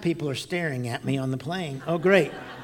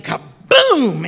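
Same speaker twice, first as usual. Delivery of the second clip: loud, high imitation of a thunderclap; explosive boom; 8 semitones higher, 9 dB louder than usual. Storyteller mimics lightning smacking plane, explosive shout. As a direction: burst out with the sound of the strike.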